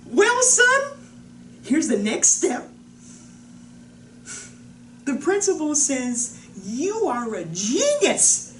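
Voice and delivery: high, loud, gushing with a smile in the voice; bright, proud mother. Retold or imitated. Mother tells her son he's a genius, proud and excited. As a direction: announce it big, stretching the key words.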